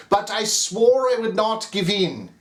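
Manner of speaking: ordinary delivery, nothing marked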